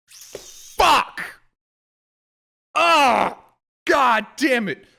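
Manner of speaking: loud, high furious cursing; furious shout. Retold or imitated. Seeing the seagull trample his meal, furious shout. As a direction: yell out in sheer frustration.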